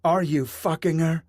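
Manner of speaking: wife shouting her accusation at her husband. A narrator's reading. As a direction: fling the accusation. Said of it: "his wife shouted".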